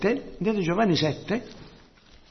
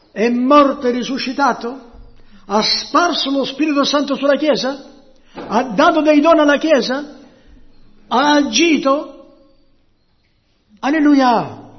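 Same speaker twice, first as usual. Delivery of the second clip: loud, high, insistent questions; full-force preaching; 7 semitones higher, 7 dB louder than usual. Real, not acted. Preacher asks the church what God has done, at full force. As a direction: fire the questions at the congregation, loudly.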